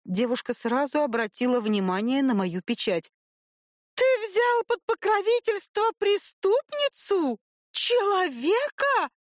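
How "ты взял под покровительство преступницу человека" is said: high squealing outrage; shrill squeal. A narrator's reading. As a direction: squeal it, much higher. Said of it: "взвизгнула она".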